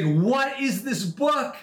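acted-out amazement, high and drawn out; astonished. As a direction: stretch the question out in high-pitched disbelief. Retold astonished phone call.

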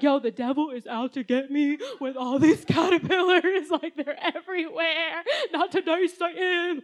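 laughing mock alarm. Retelling caterpillar sting in dryer.

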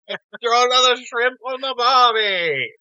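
high, slow, hammed-up Australian accent; mock Australian accent. Co-host echoes the movie line, exaggerated Australian accent. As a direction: stretch it into a big fake Australian cry. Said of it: "my favorite reference to other accents".

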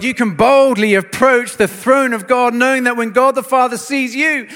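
preaching building to a loud, high climax; impassioned preaching. Real, not acted. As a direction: proclaim the good news with rising force.

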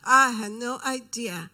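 loud, mocking echo of "I had no idea"; mocking loud repeat. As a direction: shout it back the way she threw it. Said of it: "she went through that spiel again".

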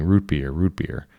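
ordinary delivery

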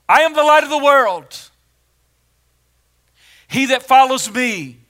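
very loud, high declaration; booming preacher quoting Jesus; thunderous proclamation. Acted. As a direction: proclaim it to the whole crowd at full force.

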